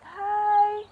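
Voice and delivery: bright high-pitched hi to a stranger; gentle high hello. Retold or imitated. Greeting crying students with ice cream.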